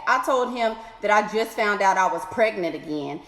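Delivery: ordinary delivery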